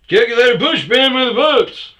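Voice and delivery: very high, loud squeaky caricature; hammed-up silly voice; mocking coworker. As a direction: mimic a rambling buddy. Mimicking coworker's babble.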